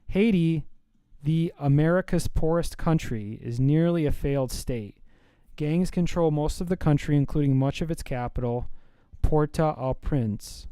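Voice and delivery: loud, projected news reading. Real, not acted. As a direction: read the report out in a big voice. Reads a news item aloud.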